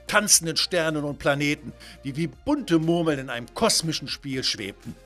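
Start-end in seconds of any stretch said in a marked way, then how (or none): none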